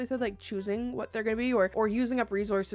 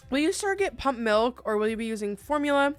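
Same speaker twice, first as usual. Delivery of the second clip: usual speaking voice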